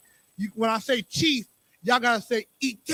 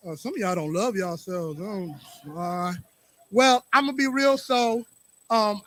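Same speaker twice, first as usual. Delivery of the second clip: normal talking voice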